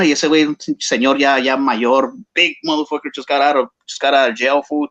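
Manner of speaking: normal talking voice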